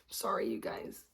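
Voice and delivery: sheepish whispered apology. Real, not acted. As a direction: whisper it, a little embarrassed. Embarrassed apology after burp talk.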